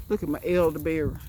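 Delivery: normal talking voice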